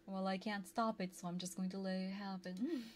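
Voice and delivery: ordinary delivery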